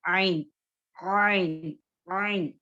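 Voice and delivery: throaty Arabic ain; ain demo. Shown as a demonstration. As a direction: pull आइन back into the throat. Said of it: "इसको कैसे पढ़ना है?".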